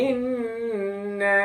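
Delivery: ইন্না said very slowly with a long doubled ন; careful pronunciation. Shown as a demonstration. Teacher showing students how ইন্না sounds, slow. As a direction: hold the ন in ইন্না, slow and deliberate. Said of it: "এই যে উচ্চারণটা এরকম হবে".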